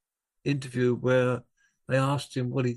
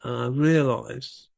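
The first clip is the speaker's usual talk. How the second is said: ordinary delivery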